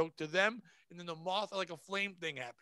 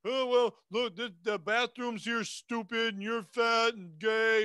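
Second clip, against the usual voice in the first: hammed-up insulting comic, louder and slow; mock rude comic; 3 semitones higher, 6 dB louder, slower than usual. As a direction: play a bad comic sneering at the crowd. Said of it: "If you go up and you're like".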